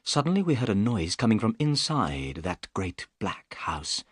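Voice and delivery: usual speaking voice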